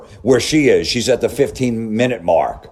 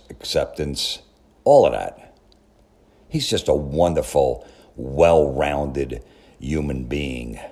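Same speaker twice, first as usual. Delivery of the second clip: sarcastic mock praise; 7 semitones lower, 6 dB quieter than usual. Sarcastic praise of the gym hero.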